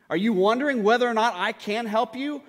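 acted confrontational questioning; challenging. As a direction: press the doubter for an answer. Acts Jesus challenging the father.

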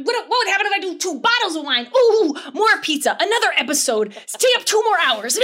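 high, loud giddy escalation; hammed-up manic excitement; runaway enthusiast. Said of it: "I'm the enthusiast".

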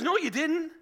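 high, loud protest; indignant disbelief. Acted. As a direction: protest in acted shock. Esau protests to Isaac.

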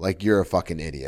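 very low, hushed self-insult; deadened aside to yourself; inner self-reproach. Retold or imitated. Comedian's inner voice after provoking three men, quiet reproach.

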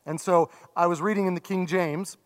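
ordinary delivery

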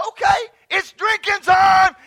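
high, very loud rallying yell; excited shout. Retold or imitated. Pastor retells joining the laughter, rallying shout. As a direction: holler it like a party call. Retold excited call to join in.

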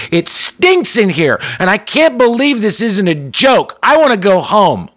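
mock-whiny date escalating her complaint. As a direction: build the irritation to a near shout. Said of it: "Her voice got louder as her agitation bubbled".

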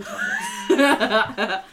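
laughing. Real, not acted. Reading own silly note aloud.

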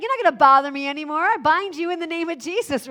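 fast, loud rebuke of the demon; commanding rebuke. Retold or imitated. Paul rebuking the demon in the girl, commanding. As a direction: cast it out with authority. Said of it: "He's like".

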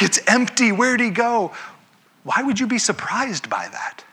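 a hammed-up startled woman; mock surprise. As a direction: play her baffled and startled. Mary voiced, higher and louder.

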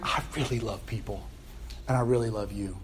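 low, hushed sincerity; tender declaration. Acted. God to Jonah after the gourd, softly loving. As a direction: drop down soft and mean it.

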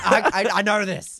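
high, loud mock exasperation; frustrated, imitated. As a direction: play an impatient driver stammering back. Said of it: "You could hear him getting frustrated".

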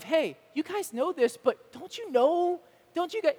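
chiding nudge. Retold or imitated. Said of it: "this idea of".

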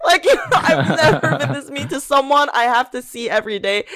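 laughing. Real, not acted. To her friend about the coworker, laughing in disbelief. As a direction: giggle as you admit it.

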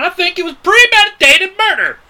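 high, loud, indignant accusation; comic outrage. Real, not acted. Accusing the friend of killing the horse, comic outrage. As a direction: build to an aggrieved cry.

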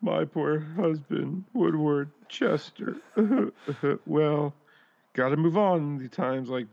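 a drawn-out fake mourning; mock grieving widow. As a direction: play the widow over the top. Voicing the widow mockingly.

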